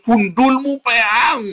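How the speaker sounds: high, drawn-out mock insult; mock outburst. Shown as a demonstration. Preacher demonstrating his choice of words, mock outburst.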